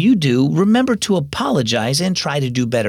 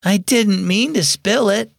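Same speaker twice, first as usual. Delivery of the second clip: little sister pleading; 7 semitones higher than usual. A narrator's reading. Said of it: "Her lower lip was trembling".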